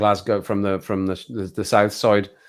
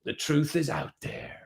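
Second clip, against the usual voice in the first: slow, softer, higher eerie delivery; mock-mysterious tagline; 4 semitones higher, 7 dB quieter than usual. Teasing viewers with a TV tagline, mock-mysterious. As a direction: let it drift out slowly and mysteriously.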